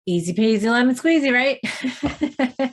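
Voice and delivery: bright little catchphrase, a laugh right after; playful. Real, not acted. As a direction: toss it off lightly and cheerfully. Playful tagline to close the thought.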